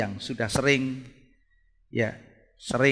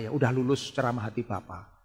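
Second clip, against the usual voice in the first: ordinary delivery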